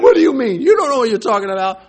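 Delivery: defensive retort. Pretend. Believer rebuffing someone who says be strong, indignant. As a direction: bristle and push the advice away. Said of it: "We can turn on them".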